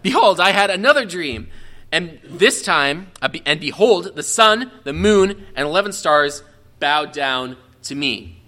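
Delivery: lively reading of an ancient text; scripture reading. Acted. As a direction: read the dream out with building wonder. Reads Joseph's second dream.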